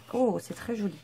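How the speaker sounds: ordinary talk, nothing marked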